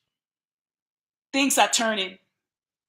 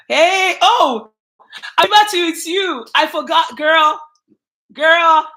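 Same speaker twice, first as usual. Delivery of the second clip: high, loud burst of delight; excited recognition; 6 semitones higher, 11 dB louder, slower than usual. Real, not acted. Host spotting a viewer in chat, loud and delighted. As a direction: squeal out the surprise of spotting her.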